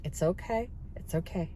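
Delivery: soft, hushed reassurance; calm her down gently; reassuring. Retold or imitated. To the flustered cashier, soft soothing reassurance. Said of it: "I'm like".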